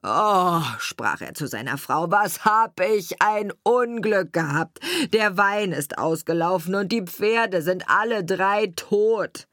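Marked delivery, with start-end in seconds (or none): 0.0-0.6 upset groan. A narrator's reading. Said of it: "ging voll Zorn und Ärger heim". 2.1-9.5 lamenting carter. A narrator's reading. Carter to his wife about lost wine and horses, woeful complaint.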